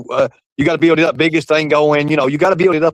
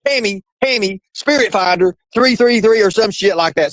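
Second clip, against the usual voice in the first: mock rant, pitched high; Mark; 8 semitones higher than usual. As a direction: imitate a worked-up host sending listeners away. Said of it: "See, I'm practicing on it".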